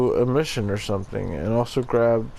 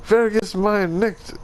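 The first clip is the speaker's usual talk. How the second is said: high, slow exclaimed last words; drowning German lover; 9 semitones higher, slower than usual. Acted. Drowning lover calling to his betrothed, hammy German exclamation. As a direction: cry out in German, pitched way up and drawn out. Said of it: "'Cause he's German".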